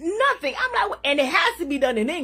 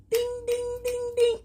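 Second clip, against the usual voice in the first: high, sung ding like a bell; bell ding imitation; 10 semitones higher, 4 dB quieter than usual. Real, not acted. Getting the answer at last, sung like a bell. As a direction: chime it out like a game-show bell.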